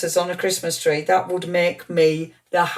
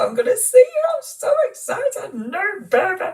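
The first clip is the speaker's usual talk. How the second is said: much higher, faster sing-song coo; baby talk. Pretend. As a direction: coo at a newborn. Cooing as if to the newborn.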